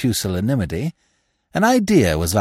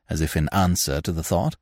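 ordinary delivery, nothing marked

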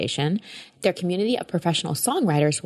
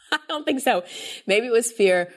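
laughing; 6 semitones higher than usual. Real, not acted. Laughing at own fear.

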